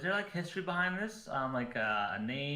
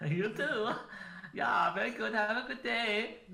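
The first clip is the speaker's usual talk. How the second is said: high, louder mocking farewell; mock high goodbye; 11 semitones higher, 3 dB louder than usual. Player parrots the shopkeeper's goodbye, high and mocking.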